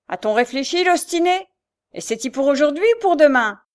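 gruff booming voice. A narrator's reading. Mother bullies the girl through the door, booming and loud. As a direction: swell the drink-roughened voice to bully. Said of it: "grossissant sa voix de rogome".

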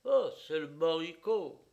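loud furious thought; with rage. A narrator's reading. Jealous friend fuming at a rival, loud. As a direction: spit out the jealous realization. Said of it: "je me disais avec rage".

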